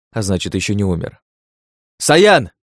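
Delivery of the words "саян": high loud call; shouting. A narrator's reading. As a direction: call out a name across the field. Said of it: "крикнул я нашего снайпера".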